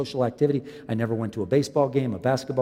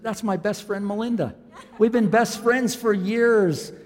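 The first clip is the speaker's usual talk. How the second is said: higher, laughing through the words; laughing reply; 9 semitones higher, 3 dB louder, slower than usual. Retold or imitated. Pastor voicing Tammy answering him, laughing higher. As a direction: giggle it out as the friend.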